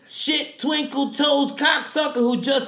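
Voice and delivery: high, loud drill sergeant tirade; hammed-up, strained military yelling; Sergeant Hartman. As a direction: shout each insult out slowly. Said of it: "Quote number two".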